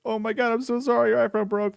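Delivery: high, gushing fake sympathy; mock sympathy. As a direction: coo over it like it's a disaster. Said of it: "It's like".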